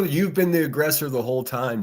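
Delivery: ordinary talk, nothing marked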